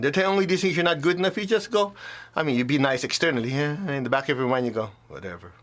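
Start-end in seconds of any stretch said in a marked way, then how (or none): 5.1-5.5 much lower and softer, a dismissive whatever; muttered brush-off. Shown as a demonstration. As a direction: shrug it off under your breath. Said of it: "that's my mantra of inner peace".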